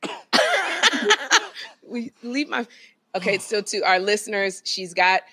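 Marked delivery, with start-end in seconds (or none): 0.1-1.6 high burst of laughter. Real, not acted. Face being squeezed, laughing.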